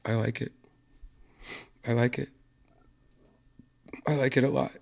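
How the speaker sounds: understated murmur with little push; talking softly to spare voice. Real, not acted. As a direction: save the voice, keep it quiet. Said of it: "I'm just going to talk like this".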